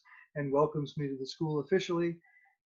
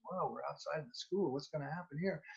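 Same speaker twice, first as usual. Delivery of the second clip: put-on hushed, much softer aside as the worried kid; apprehensive kid; 11 dB quieter than usual. Kid wondering what happens outside the school, hushed apprehension. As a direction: voice the kid's uneasy thought under your breath. Nervous kid's thoughts in parking lot.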